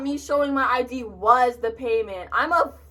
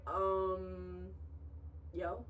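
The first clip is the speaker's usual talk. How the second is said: much softer, awkward little mumble; mumbled um yo; 4 semitones lower, 17 dB quieter than usual. Shown as a demonstration. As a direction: barely get the "Um, yo." out, mortified. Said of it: "Like, literally like that".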